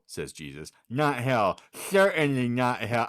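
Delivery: ordinary talk, nothing marked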